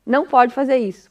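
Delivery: ordinary talk, nothing marked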